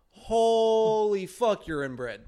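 mock shock. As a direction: stretch it out like stunned listeners. Mimicking friends' shocked reaction.

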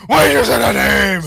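hammed-up superhero scream, loud and high. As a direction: blast it out in mock outrage. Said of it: "he's just screaming, going".

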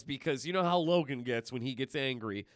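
normal talking voice

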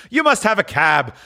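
high, merry Scrooge; chuckling good humor. Acted. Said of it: "The chuckle with which he said this".

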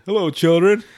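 put-on big sing-out greeting to kids; Chef. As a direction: call it out as a cartoon cook. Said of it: "good old Chef, I miss that, uh, voice actor".

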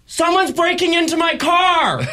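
put-on high, slow alarmed shout in an old lady voice; old lady yelling. Old lady spots a stranger at her car, shouting high. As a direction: yell it out across the lot like a startled old lady. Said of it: "all of a sudden I hear".

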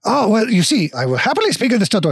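quick, eager mock-French dwarf; bad French accent. Stilt dwarf happily starts explaining his people, bad French accent. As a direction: launch into a hammy French accent. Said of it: "very shitty French accent".

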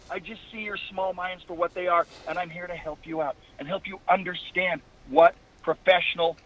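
heel wrestling promo, big swings. Pretend. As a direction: cut a cocky heel promo to the crowd. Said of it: "Give me a promo for what's going on tonight".

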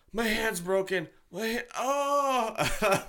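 high, drawn-out mock whimper; hammed-up high wail of pain; suffering boyfriend.

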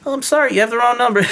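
very high, quick put-on voice; disguised voice. Fooling old contacts who call, high disguised voice. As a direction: disguise yourself in a squeaky-high, apologetic voice. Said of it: "I would change my voice".

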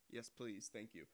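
normal talking voice

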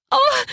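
short high yelp. Acted. Character reacts to a smack.

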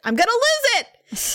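high-pitched, drawn-out exasperated cry; hammed-up losing it; mock outburst. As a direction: blow up theatrically, stretching it high. Threat repeated as an outburst.

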